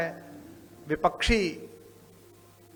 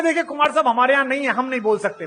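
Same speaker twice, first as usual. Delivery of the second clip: higher, louder voice for the flustered seatmate; seatmate's excuse; 7 semitones higher, 5 dB louder, faster than usual. Retold or imitated. Seatmate on the flight explains to Kumar, higher and louder. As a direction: play the nervous politician dodging the question.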